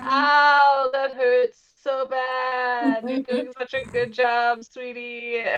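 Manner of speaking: drawn-out ow melting into praise; mock-pained sweet talk; pained encouragement. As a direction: play hurt, slowly, while cooing encouragement. Bitten, still praises the hippo.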